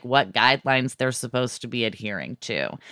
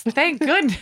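mock relief; 10 semitones higher than usual. Exaggerated relief, high far.

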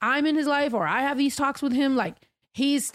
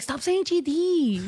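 mock scolding, slow and higher; prim, slow correcting of a friend; lightly hammed-up scold; preachy younger self; 5 semitones higher, slower than usual. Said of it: "and I'd be like".

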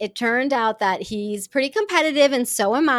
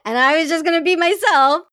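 laughing; 6 semitones higher, 7 dB louder than usual. Real, not acted. Laughing as she recalls thinking it was practice.

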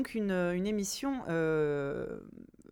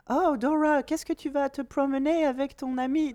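English accent; 5 semitones higher than usual. Shown as a demonstration. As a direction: caricature an English speaker's French accent. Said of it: "moi je fais l'accent anglais". (accent anglais caricatural en français)

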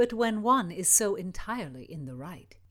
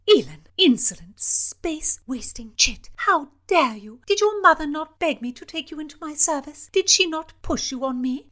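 high, loud furious scolding; 10 semitones higher, 7 dB louder than usual. Acted. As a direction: rage at the disgraced girl. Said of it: "Cecilia fumed".

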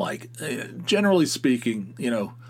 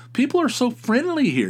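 mock gush, much higher and louder; gushing tourist woman; 13 semitones higher, 4 dB louder than usual. Retelling the tourist woman's praise to the guard, high mock gush. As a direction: squeal it like an overjoyed tourist.

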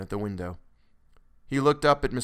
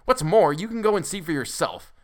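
higher, louder angry dismissal; cutting; 7 semitones higher, 5 dB louder than usual. A narrator's reading. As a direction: send him off indignantly. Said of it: "answered the doctor cuttingly".

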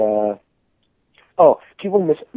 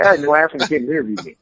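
ordinary delivery

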